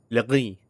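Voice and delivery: "Legris" with throaty R and silent S; French-style "Legris"; French pronunciation. Shown as a demonstration. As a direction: say "Legris" as luh-GREE with a back-of-throat R. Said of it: "it's actually pronounced legris".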